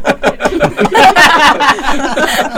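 loud, high burst of laughter. Real, not acted. Co-host reacting to the skunk story, laughing hard. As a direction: crack up helplessly.

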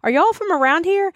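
a hint of Alabama waitress asking where you're from; Southern waitress. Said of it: "she turns around and said".

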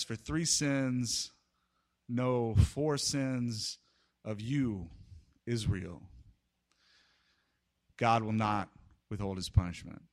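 low, slow, soft and grave; hushed pronouncement. Retold or imitated. Amos turning the judgement on Israel, hushed. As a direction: let each phrase fall quietly between pauses. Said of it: "he pauses".

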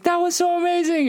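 put-on a child bursting with joy; high excited kid voice; thrilled kid. Son thrilled after the jump.

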